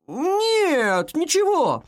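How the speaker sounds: high, drawn-out denial; carrying, high-pitched reply; shouting back. A narrator's reading. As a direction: call the answer down through the house. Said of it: "крикнул он в ответ".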